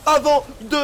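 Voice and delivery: fast, rising live esports call; excited play-by-play. Real, not acted. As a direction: call the fight with mounting hype. Caster hypes teamfight live.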